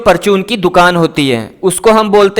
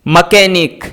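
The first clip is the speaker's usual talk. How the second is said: ordinary talk, nothing marked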